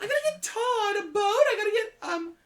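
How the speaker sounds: put-on high, frantic young woman's voice; B, panicked roommate. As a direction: play B squeaky and flustered. B talking about boat.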